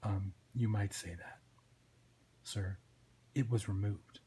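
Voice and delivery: low, soft, hesitant report; understated, obedient sidekick; meek deputy Twitch. Acted. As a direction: break it to the boss quietly.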